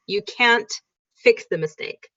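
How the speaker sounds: ordinary talk, nothing marked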